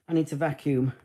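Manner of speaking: normal talking voice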